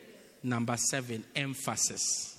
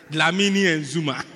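chuckling through "Dlamini and Zuma"; amused repeat of the firm name; laughing; 9 dB louder than usual. Acted. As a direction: say the names with a laugh.

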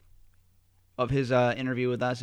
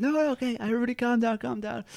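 lightly voiced higher-pitched pleading; pleading peacemaker; 10 semitones higher than usual. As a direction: try to settle a family fight. Acting out step-grandfather calming everyone.

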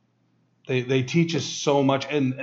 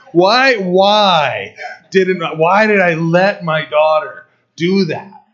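slow, louder, drawn-out why; exasperated; 5 semitones higher, 6 dB louder, slower than usual. Real, not acted. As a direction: scold yourself in disbelief. Dad's exasperated self-reproach.

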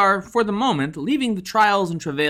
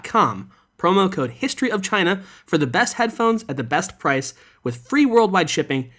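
infomercial; 4 dB louder than usual. Acted. Sponsor ad read.

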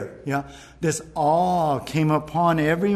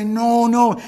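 acted straining to speak, higher pitch; emotional protest; 7 semitones higher, 4 dB louder than usual. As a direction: play a man struggling to object. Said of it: "getting all emotional and trying".

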